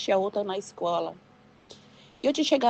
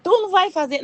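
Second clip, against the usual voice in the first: higher, drawn-up insistent question; insistent asking; 10 semitones higher, 3 dB louder, faster than usual. Acted. God asking the refusing believer once more, insistent. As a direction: press the question harder.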